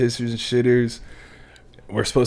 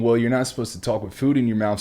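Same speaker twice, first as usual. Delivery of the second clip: ordinary delivery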